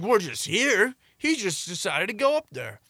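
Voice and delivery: high-pitched mock innocent protest; feigned innocence. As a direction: plead ignorance in a put-on high voice. Feigning innocence to the club owner.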